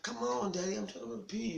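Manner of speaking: put-on whiny child voice, pitched well up; pleading child. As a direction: play a little kid begging daddy. Child pleading with dad at night.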